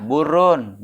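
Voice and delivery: "burun" read with a u on the ba, bu-run; burun with a dammah. Shown as a demonstration. Teacher showing the dammah reading to students, clearly pronounced. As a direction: say "burun" with u on the first letter. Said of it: "Ente baca domah".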